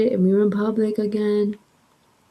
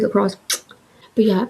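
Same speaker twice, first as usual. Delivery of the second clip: usual speaking voice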